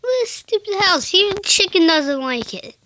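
high, loud hammed-up whine; fake-sob over the ugly house; mock crying.

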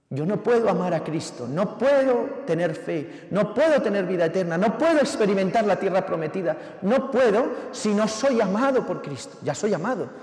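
loud, high, impassioned preaching; full-force preaching. Acted. Preacher urging his congregation, preaching at full force. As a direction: drive it home to the congregation.

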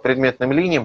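normal talking voice

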